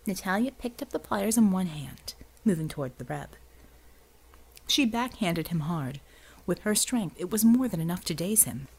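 ordinary delivery, nothing marked

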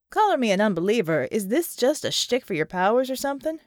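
gruff special ops man doubting magic; skeptical soldier. Acted. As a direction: challenge her, unconvinced. John scoffs at magic.

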